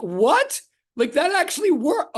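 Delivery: high, loud disbelief; amazed it worked; surprised. Real, not acted. Reacting to the joke.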